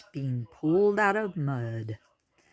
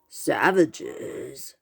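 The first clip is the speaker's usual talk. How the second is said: brazen boar voice. A narrator's reading. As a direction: declare it like a proud team name. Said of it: "a brawny boar with a brazen voice".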